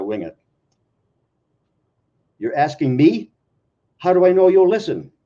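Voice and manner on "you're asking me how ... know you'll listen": lightly voiced wife, quicker, louder at the end; a hint of a teasing wife; wife's voice Jean. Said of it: "I'll try to make two voices".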